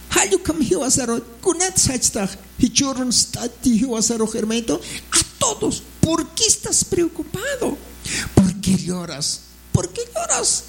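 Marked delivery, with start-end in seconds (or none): 0.1-7.8 high-pitched, urgent exhortation; impassioned preaching. Real, not acted. Preacher asks flock why they worry, high impassioned preaching. 9.7-10.6 "¿Por qué lloras?" pitched very high; high pleading question. Acted. Preacher repeats Jesus' question to the flock, high-pitched exhortation.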